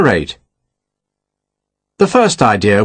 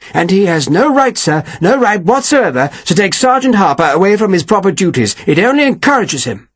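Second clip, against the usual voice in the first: lightly raised, indignant captain; a hint of a pompous officer's grievance; Slingsby's voice; 7 semitones higher than usual. A narrator's reading. Captain complaining to his colonel, mildly indignant.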